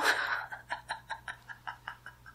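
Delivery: long breathy snickering under the breath; impish giggle. Acted. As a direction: giggle on and on at the prank. Demon laughs at her prank.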